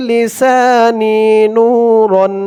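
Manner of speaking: আল্লাহুম্মা drawn out very slowly with long hold; slow recitation. Shown as a demonstration. Teacher modelling pronunciation and elongation, slow recitation. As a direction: intone আল্লাহুম্মা with a long stretch. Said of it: "ওয়া ফি".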